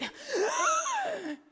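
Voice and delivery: sharp high gasping breath; big high gasp. Retold or imitated. Mother arriving out of breath, high gasp. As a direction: gasp for air after the run.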